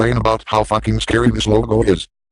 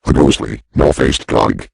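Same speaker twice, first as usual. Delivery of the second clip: creepy voice; 9 semitones lower than usual. Acted. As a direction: read it like a haunting tale. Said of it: "I will read about the dog in a creepy voice".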